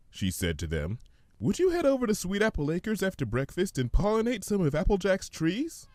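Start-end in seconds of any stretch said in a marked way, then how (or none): 1.4-5.9 much higher, gentle pony voice; Fluttershy. Acted. Fluttershy answering the hummingbird, high and gentle.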